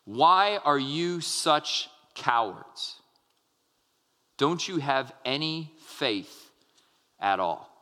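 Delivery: slow, low, quiet scolding; reprimand. Acted. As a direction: reprimand them slowly, letting the voice sink at the end. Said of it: "He reprimands them".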